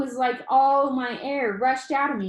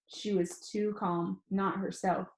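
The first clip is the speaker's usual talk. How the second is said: usual speaking voice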